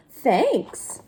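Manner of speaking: ordinary delivery, nothing marked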